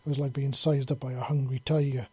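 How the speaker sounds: usual speaking voice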